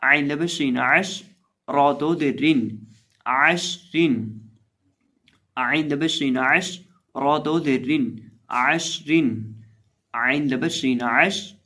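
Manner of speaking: spelled out slowly and loudly, syllable by syllable; slow spelling drill. Shown as a demonstration. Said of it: "اب اس لفظ کے اندر بھی".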